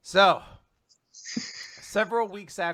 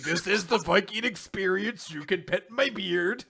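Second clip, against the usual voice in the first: mock Viking host; 5 semitones higher, 6 dB louder than usual. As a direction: play it up as a cheesy Viking host. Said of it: "They're like".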